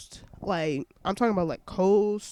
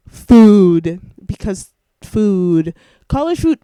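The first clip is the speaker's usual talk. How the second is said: loud, stressing food; emphatic shout; 15 dB louder, slower than usual. Real, not acted. Loud emphatic rant about food.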